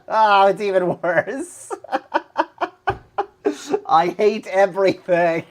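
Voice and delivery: high, loud exasperated laugh. Real, not acted. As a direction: laugh through the frustration. Laughing frustration.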